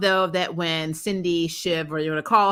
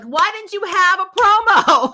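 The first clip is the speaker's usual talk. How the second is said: exasperated shout; 13 semitones higher, 9 dB louder than usual. Retold or imitated. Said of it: "I'm like".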